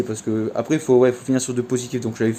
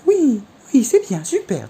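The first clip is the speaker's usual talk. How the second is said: much higher, sing-song praise; praising the dog; 11 semitones higher, slower than usual. Retold or imitated. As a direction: lift the voice high to reward him. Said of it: "faut jouer comme ça avec sa voix".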